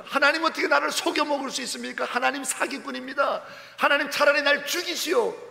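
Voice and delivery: high, loud outraged railing at God; angry accusation. Acted. Preacher voicing Elijah, railing at God in anger. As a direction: hurl blame upward in anger.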